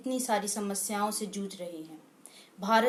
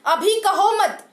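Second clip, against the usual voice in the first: higher, louder declamation, building to the end; impassioned poem recitation; 4 semitones higher, 5 dB louder than usual. Acted. Presenter rouses countrymen against enemies within, impassioned poem recitation. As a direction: declaim the verse as a stirring rallying cry.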